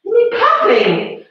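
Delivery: high, slow, sing-song tease; drawn out to rib someone who's out of breath; mock-scolding the class; playful teasing. Real, not acted. Teasing a puffing class member.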